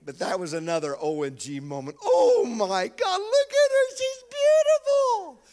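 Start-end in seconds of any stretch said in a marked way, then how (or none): 2.0-5.4 much higher, louder cry of wonder; father's delighted outburst at first sight of his girl; overjoyed gasp. Retold or imitated. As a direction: gush over the baby, breathless with joy. Father sees newborn daughter.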